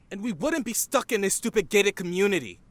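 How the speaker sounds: heated protest. Acted. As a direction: argue it back hotly. Teen resents being stuck there.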